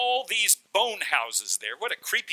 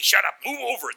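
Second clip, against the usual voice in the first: higher, slightly louder bickering; squabbling demon; 5 semitones higher, 4 dB louder than usual. Acted. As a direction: jostle for room as a demon. Demons squabbling inside one man.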